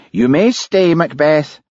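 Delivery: high, fussy concession. A narrator's reading. Police boss lets Hamish stay, fussy high voice. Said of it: "said Daviot fussily".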